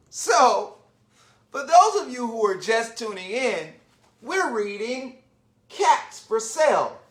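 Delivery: ordinary delivery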